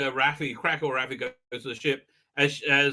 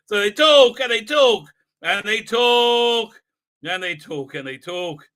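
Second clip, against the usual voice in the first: mocking repetition; 5 semitones higher, 8 dB louder, slower than usual. Real, not acted. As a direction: start loud and drawn out, let it die away. Mocking endless dialogue scenes.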